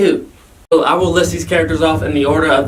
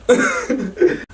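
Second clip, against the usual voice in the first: light laugh; 6 semitones higher, 3 dB quieter than usual. Real, not acted. Laughs at own stumble.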